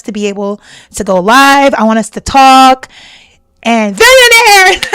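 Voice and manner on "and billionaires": excited shout. Real, not acted. Chat name idea, excited.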